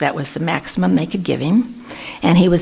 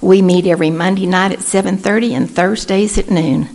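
normal talking voice

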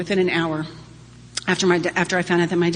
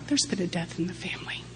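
hushed, breathless whisper; 9 dB quieter than usual. Retold or imitated. Friend whispering to her boss about a death. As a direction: whisper it like grave news.

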